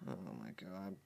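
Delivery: soft, low despairing groan; defeated murmur. Real, not acted. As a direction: sink into quiet despair.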